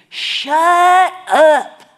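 very loud, high yell; angry retort shout. Retold or imitated. Pastor snapping back at a biker, furious mock shout. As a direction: blast it back, drawn out.

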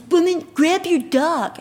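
high, drawn-out bossy order; rude cyclist. Retold or imitated. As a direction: imitate a rude cyclist ordering someone about. Retells a rude cyclist yelling.

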